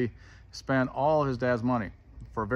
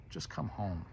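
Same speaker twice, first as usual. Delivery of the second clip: soft appeal; 2 semitones lower, 10 dB quieter than usual. Real, not acted. Preacher inviting the listeners to come home, soft appeal.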